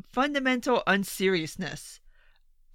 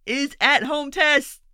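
slow, louder and higher; pressing the point; stressed; 5 semitones higher, 4 dB louder, slower than usual. Real, not acted. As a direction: lean hard on each word. Ranting about needed tests.

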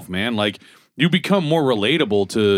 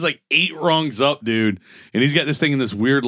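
usual speaking voice

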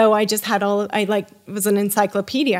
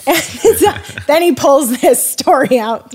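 laughs through the words, pitch up and louder; laughing while telling; 6 semitones higher, 6 dB louder than usual. Real, not acted. Laughing as she tells how the host starts his story. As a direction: let the laugh spill into the line. Retelling a funny dinner moment.

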